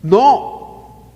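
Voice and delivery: ordinary talk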